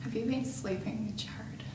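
barely voiced, hushed accusation; tense whisper. To Sarah, hushed whisper about the rumors. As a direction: whisper it so nobody else hears.

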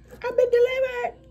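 very high-pitched comic proclamation; mock testimony. Joking that they no longer like girls, high mock testimony. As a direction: declare it in a squeaky put-on voice.

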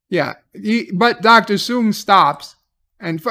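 ordinary delivery

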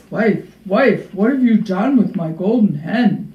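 loud, emphatic giant calling out. A narrator's reading. Giant calls to his wife about his hen, calling out. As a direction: call out loudly like a storybook giant. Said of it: "Jack heard him calling".

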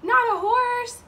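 high, drawn-out correction; exaggerated storybook denial; emphatic no. A narrator's reading. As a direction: open loud on the no. Emphatic answer to the children.

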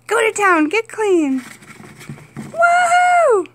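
high, loud cheering for the pet; delighted owner whooping at her chinchilla; excited cheer. Real, not acted. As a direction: cheer him on, rising into a whoop. Cheering chinchilla's dust bath.

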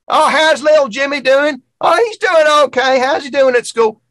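put-on a high, friendly old lady chatting at the post office counter; sweet little old lady. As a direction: chirp it like a kindly small-town lady. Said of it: "there was, like, a little lady at the front".

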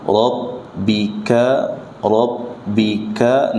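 Rabbika slow, r doubled but not trilled; unrolled r. Shown as a demonstration. Teacher showing rabbika without rolling. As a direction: hold the r without bouncing. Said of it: "jangan dibaca dengan mantulan".

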